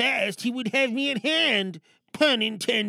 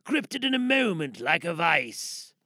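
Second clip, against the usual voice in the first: story narration; 4 semitones lower, 3 dB quieter than usual. Acted.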